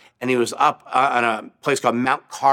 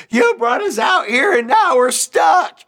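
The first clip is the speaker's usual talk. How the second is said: high, loud accusing shout at Moses; mock panicked Israelites shouting at Moses; panicked crowd yell; 11 semitones higher, 4 dB louder than usual. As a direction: yell it like a scared crowd trapped at the sea. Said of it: "I don't know if that was their accent".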